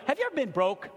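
usual speaking voice

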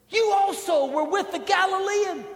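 high, pointed accusing voice; servant girl accusing. Retold or imitated. Preacher voicing the servant girl accusing Peter, high and pointed. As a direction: lift the pitch to play the servant girl. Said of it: "a servant girl comes up to him and says".

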